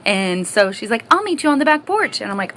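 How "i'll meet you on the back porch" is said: higher, bright mock-mom voice asking; imitating mom. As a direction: play her mom, pitched up and sweet. Mom voice again.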